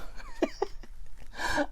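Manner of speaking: high squeaky burst of giggling; high giggle. Real, not acted. Cracking up at her own joke about her sibling, giggling. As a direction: break into a helpless high giggle. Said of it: "now I'm laughing".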